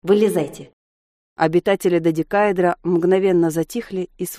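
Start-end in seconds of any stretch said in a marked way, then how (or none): 0.0-0.7 terse, dry order; matter-of-fact command; dry demand. A narrator's reading. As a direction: demand it drily, without fuss. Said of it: "сухо потребовал".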